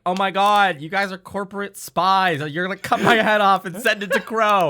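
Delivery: high, loud hammed-up panic; mock panic. Young accuses the party of spying, mock panic. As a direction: squeal the paranoid accusation. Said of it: "Young being like".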